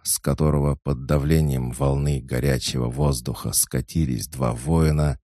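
ordinary delivery, nothing marked

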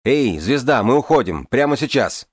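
loud call across a room; yelling across the room. A narrator's reading. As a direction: shout it across the tavern. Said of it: "И он крикнул через всю комнату".

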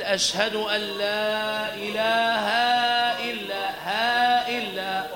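إله stretched very slowly with an extra alif; wrong lengthened ha. Shown as a demonstration. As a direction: drag out إلها. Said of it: "الإتيان بألف بعد الهاء".

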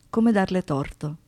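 ordinary talk, nothing marked

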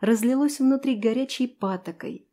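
ordinary delivery, nothing marked

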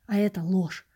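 ordinary delivery, nothing marked

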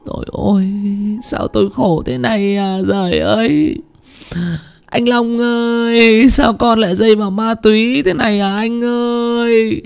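high, slow keening wail; wailing lament. Acted. Widow wails over her dead husband and drugged son, slow and high. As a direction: mourn aloud as if your heart is breaking. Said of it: "bà lại khóc lóc".

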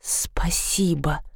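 soft unvoiced thank-you; whisper. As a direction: breathe it out slowly. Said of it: "сказала Василиса". (шёпотом)

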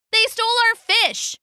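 high, indignant outcry; penguin crowd. A narrator's reading. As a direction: pipe up as an accusing penguin. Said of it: "the crowd confirmed".

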